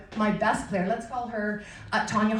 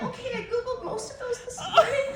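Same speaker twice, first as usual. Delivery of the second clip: much higher, laughing admission; sheepish giggle through the words; laughing confession; 12 semitones higher than usual. Retold or imitated. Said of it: "and I was like".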